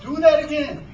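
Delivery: high-pitched eager command; commanding order. A narrator's reading. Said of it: "he ordered".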